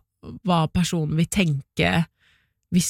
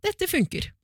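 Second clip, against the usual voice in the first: satisfied inner voice; 12 semitones higher than usual. Retold or imitated. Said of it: "magefølelsen sånn".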